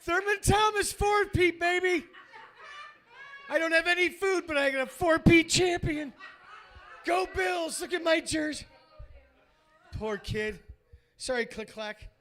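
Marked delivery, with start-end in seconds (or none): 0.0-8.6 hammed-up starving fan. Starving Burundi kid bragging about his Bills jersey, hammed-up shout. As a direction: yell it like a starving kid bragging about a fake four-peat. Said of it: "They're like".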